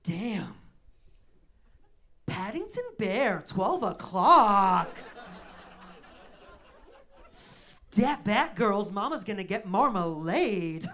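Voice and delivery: loud, slow, brassy come-on; sassy New York girl. Retold or imitated. A New York girl spotting him in the bar, loud and sassy. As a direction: strut in like a bold New Yorker. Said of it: "she'd be like".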